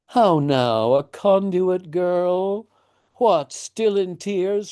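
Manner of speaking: put-on high, slow singsong as if to a two-year-old; doting father's slow, high baby talk; talking to a toddler. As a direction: coo at her like she's a baby. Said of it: "as you would to a two-year-old".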